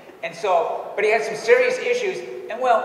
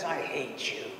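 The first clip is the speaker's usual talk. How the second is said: low, soft acted threat; quiet menace; 5 semitones lower, 11 dB quieter, slower than usual. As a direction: imitate a possessed man, hushed and low. Acting possessed man's threat.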